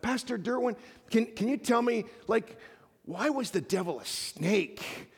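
put-on high, earnest child's question; little girl. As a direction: ask it like a curious toddler. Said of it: "little three or four-year-old girl".

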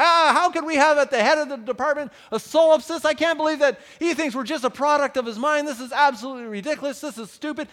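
loud, high, hammed-up outraged rant; exaggerated imitation of a furious professor; mad rant. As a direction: rail at the solipsist department head, laughing through it. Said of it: "my professor is getting mad".

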